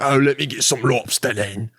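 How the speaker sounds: put-on Cockney accent. Cockney eyeing a lobster meal, broad mock accent. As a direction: play a rough-and-ready Cockney. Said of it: "some Cockney person be like".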